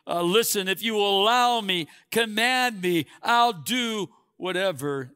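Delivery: excited plea to be sent; joyful, excited. Pretend. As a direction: burst out with joy, keen to serve. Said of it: "joyfully, uh, excitedly".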